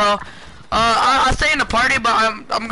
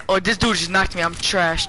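ordinary talk

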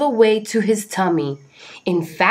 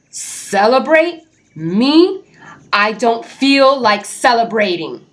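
grumpy refusal; 5 semitones higher, 5 dB louder, slower than usual. Acted. Jim refuses again, grumpy.

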